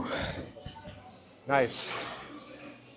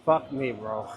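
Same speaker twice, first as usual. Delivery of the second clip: ordinary delivery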